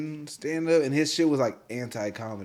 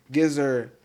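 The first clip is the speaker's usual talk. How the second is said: ordinary delivery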